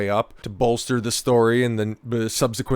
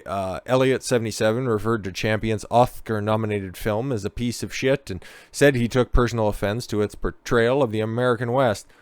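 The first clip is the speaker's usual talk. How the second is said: ordinary talk, nothing marked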